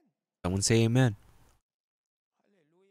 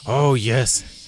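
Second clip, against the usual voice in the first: louder, put-on holy "Oh, yes"; mock pious voice; 3 semitones higher, 5 dB louder than usual. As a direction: ham up a churchy exclamation. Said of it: "and I change my voice".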